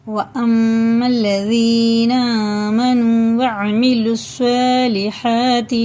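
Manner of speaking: slow melodic chanted recitation; Quran recitation. Acted. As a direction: chant the verse slowly with long vowels.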